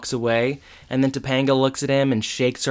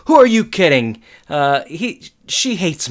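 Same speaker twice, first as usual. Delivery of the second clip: louder, higher exasperated outburst; mock exasperation; 5 semitones higher, 6 dB louder, slower than usual. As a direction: act out a distressed teen's protest. Corey's upset reply acted out in a recap.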